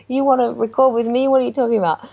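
high, incredulous what; stunned disbelief. Retold or imitated. Stunned reaction to Ricky's offer.

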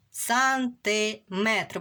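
сантиметр said slowly, syllable by syllable; slow dictation. Shown as a demonstration. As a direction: stretch out сантиметр clearly for dictation. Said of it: "чітко промовили це слово". (повільно, чітко: сантиметр)

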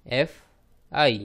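ordinary talk, nothing marked

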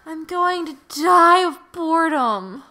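high, drawn-out whine; whiny bored friend. Acted. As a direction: beg dramatically for rescue. Voices Mai whining.

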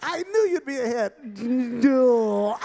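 high, loud puppet voice with gruff no; dummy voice for Jake. Acted. Ventriloquist voicing Jake's dummy, high and loud. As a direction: ventriloquist bit with a stubborn dummy.

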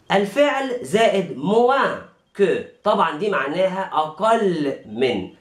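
ordinary delivery

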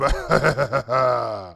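long, low ha-ha-ha; gloating laugh. Pretend. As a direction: laugh triumphantly at the rivals.

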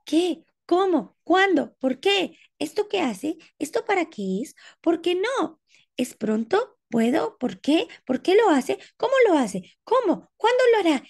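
put-on high, eager little girl firing questions; a small girl pestering with question after question; curious child. Niña curiosa acosando a preguntas, voz aguda.

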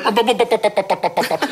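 rapid run of popping "bop" sounds; imitated babble, a string of bops; waiter's babble. Waiter fobbing off Australian diners, mock babble. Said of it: "and he's gone".

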